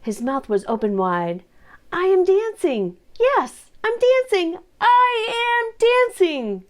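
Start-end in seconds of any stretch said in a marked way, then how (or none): 1.9-6.7 much higher and louder, thrilled shout; joyful cry. Acted. Gerald discovering he can dance, shouting with joy. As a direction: burst out with delight, stretching the last line slow. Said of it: "Gerald cried".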